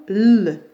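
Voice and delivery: the bare consonant L, held instead of naming the letter; isolated L sound. Shown as a demonstration. Teacher introducing the sound to pupils, L held as "lll". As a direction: say L as a long "lll". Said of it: "donc le son L".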